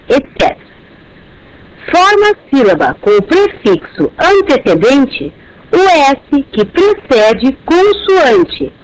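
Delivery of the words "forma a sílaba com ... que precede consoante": ordinary talk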